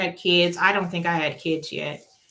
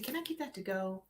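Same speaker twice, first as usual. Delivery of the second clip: much softer, discreet; quiet polite request; 15 dB quieter than usual. Retold or imitated. Asking server quietly.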